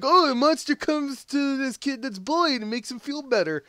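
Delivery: high, singsong mock-naive voice; pitched well up, sweetly dismissive; mock kid-movie summary. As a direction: make it sound like a sappy children's story. Mimics family calling it kid movie.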